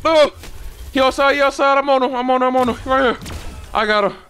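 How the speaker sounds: loud, high, excited callouts; urgent callouts. Real, not acted. Player spotting someone outside for teammates, urgent callouts. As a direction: call it out urgently to your teammates.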